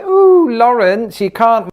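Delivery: high, slow, stretched Ooh of a scandalised fan; mock shocked viewer. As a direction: whine it like a horrified Brit watching. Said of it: "I know what you're thinking".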